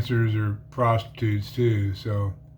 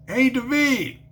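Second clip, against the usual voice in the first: high impatient call; calling the dealer from the bar stool; 10 semitones higher, slower than usual. Retold or imitated. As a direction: holler his name again.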